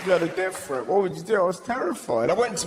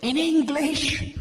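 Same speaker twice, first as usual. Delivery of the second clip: high, drawn-out coo of mock wonder; impressed mum; 9 semitones higher, 5 dB louder, much slower than usual. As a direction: coo in naive amazement, very high. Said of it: "And she looked at me and went".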